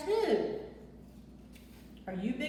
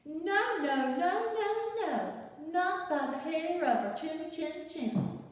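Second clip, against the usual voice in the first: higher sing-song no, no, no; pigs refusing; 6 semitones higher than usual. Acted. Little pigs refusing the wolf once more, sing-song. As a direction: chant the refusal.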